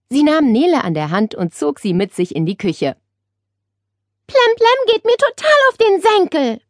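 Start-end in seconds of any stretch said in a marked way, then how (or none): 4.3-6.6 high, exasperated grumble; complaining. A narrator's reading. Nele to her aunt about the parrot, complaining. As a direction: gripe in a much higher voice. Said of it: "beschwerte sich Nele".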